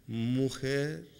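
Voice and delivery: solemn, slow, quiet film Jesus; movie Jesus parody. Retold or imitated. Preacher mocking how films show Jesus, slow and solemn. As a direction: ham up the Hollywood Jesus, drawn out and hushed. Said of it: "Ya las películas nos han puesto un cuadro".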